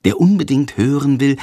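usual speaking voice

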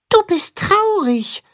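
very high, slow and delicate; tender mole voice. Acted. Mole gently tells the sad carp, high and tender. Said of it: "vermutet der Maulwurf mit zarter Stimme".

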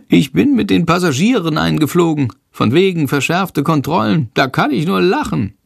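high, cocky bat voice; boastful little bat. A narrator's reading. Bat boasts to the boy about slipping past controls, high-pitched. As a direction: brag in a high little creature voice.

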